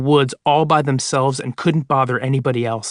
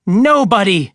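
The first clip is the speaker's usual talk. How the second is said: higher, louder sneer; 6 semitones higher, 5 dB louder, slower than usual. Acted. Woman sneers at the voice, loud sneer. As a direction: spit it out with contempt. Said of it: "she sneered".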